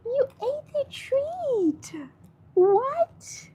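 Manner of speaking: high, slow delighted cooing at the dog. Real, not acted. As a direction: gush over the dog in disbelief. Shy dog finally eats treat.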